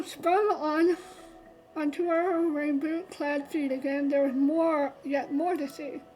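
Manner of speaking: normal talking voice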